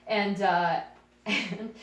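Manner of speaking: usual speaking voice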